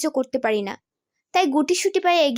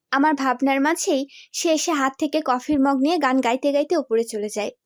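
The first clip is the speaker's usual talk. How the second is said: plain reading voice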